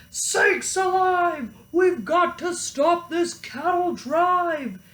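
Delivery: high, slow urgent deputy voice; deputy rallying to stop them; deputy exclaiming. A narrator's reading. Deputy urging the sheriff, high and slow. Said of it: "his deputy said".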